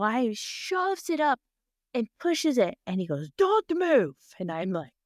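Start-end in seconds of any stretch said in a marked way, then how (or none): 3.4-4.1 high, loud mock command; retold stern order. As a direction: imitate a surgeon yelling an order, pitched way up. Surgeon barks order at her.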